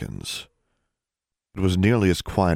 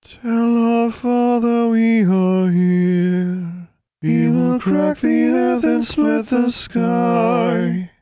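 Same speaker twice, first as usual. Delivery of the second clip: high, slow, louder plant chorus; pleading voices; 6 dB louder, much slower than usual. Acted. The corn voices plead with Alexandra for help. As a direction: beg with fading hope. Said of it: "The voices pleaded with her".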